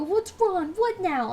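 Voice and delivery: high, worried customers; alarmed and rushed; panicked. Retold or imitated.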